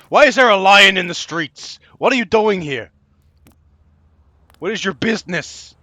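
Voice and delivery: high, loud outburst at the lion; incredulous shout. Real, not acted. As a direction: yell it in disbelief. Lion walking in the street.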